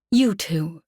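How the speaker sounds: a light snap, lightly voiced; curt order to the ones on the ground; snapping. A narrator's reading. Customer snapping at the two entertainers. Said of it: "she snapped at the two entertainers".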